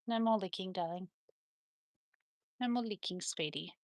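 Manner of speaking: very soft, fond reproof; gentle chiding. Real, not acted. Owner tells licking dog to stop, soft and gentle. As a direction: chide a pet under your voice, tenderly.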